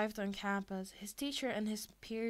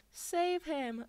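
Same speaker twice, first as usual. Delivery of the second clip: higher, louder pleading; plea; 7 semitones higher, 4 dB louder than usual. A narrator's reading. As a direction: beg for help. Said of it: "Uraka pleaded".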